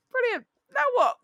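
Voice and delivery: high, sarcastic outburst; mock exasperation. Storyteller reacting to being covered in sick, high sarcastic exasperation. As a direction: throw it out high-pitched, mock-fed-up.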